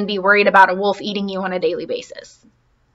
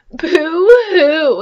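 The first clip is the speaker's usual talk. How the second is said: high, hammed-up boo-hoo; mock crying; 7 semitones higher, 4 dB louder than usual. Little Beaver cries by the pond, a hammed-up high boo-hoo. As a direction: wail it like a sulky child. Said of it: "he said".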